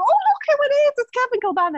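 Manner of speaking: very high, excited fan squeal; fangirling. Retold or imitated. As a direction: gush like a starstruck fan spotting a footballer. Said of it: "like, fangirling".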